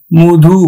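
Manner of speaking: মধু said "mo-dhu", first vowel a rounded o; low, emphatic "mo-dhu" for মধু; closed O sound. Shown as a demonstration. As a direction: demonstrate মধু syllable by syllable, "mo" then "dhu". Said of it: "আমরা উচ্চারণ করব".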